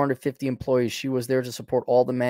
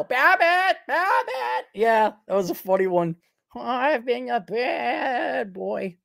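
high, squealed cartoon comedy-duo voice; Looney Tunes Babbitt and Costello; 8 semitones higher, slower than usual. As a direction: call out high like a classic cartoon sidekick. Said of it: "reminds me of Looney Tunes, Babbitt and Costello".

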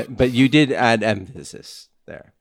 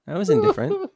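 high mock voice; faster than usual.

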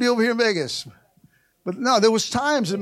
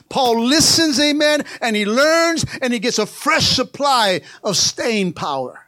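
loud, fervent preaching; 4 semitones higher, 5 dB louder than usual. Acted. Preacher to church, loud fervent preaching.

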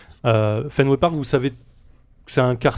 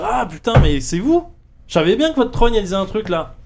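gruff sergeant; 8 semitones higher than usual. Acted. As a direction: bark it like an impatient cop who knows them. Angry sergeant greets detectives.

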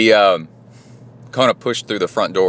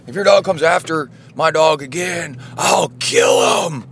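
angry threat; 9 semitones higher than usual. Retold or imitated. Old neighbor shouting a threat at the dog owner, furious. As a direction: bellow it like a furious big neighbor. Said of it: "like that".

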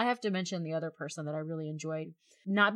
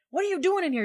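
sharply higher, half-yelled question; startled challenge; 11 semitones higher, 5 dB louder, faster than usual. Retold or imitated. Man catching her in the computer room, startled and sharp. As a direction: catch an intruder red-handed.

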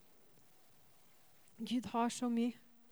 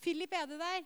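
higher call across the building; mother calling for her son; 6 semitones higher than usual. Retold or imitated. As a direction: call for a missing son, raised and searching. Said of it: "Og jeg ropte".

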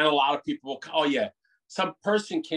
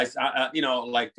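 ordinary talk, nothing marked

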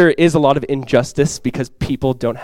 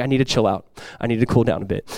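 low, soft self-calming voice; cooling down; 5 semitones lower, 7 dB quieter than usual. Retold or imitated. Preacher voicing an angry leader calming himself, low and soft. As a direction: drop it low and talk yourself down. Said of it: "he gets very angry, and then".

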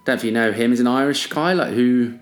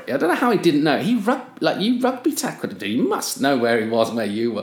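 normal talking voice

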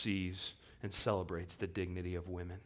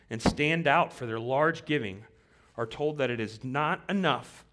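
louder, driving reading of Calvin's words; forceful reading; 3 semitones higher, 6 dB louder than usual. Acted. Preacher reading Calvin to the rich, loud and driving. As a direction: read the old quote like a charge to the rich.

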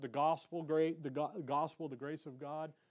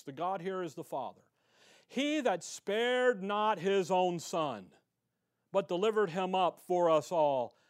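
scripture reading; 5 dB louder than usual. Acted. Reads Romans eight aloud.

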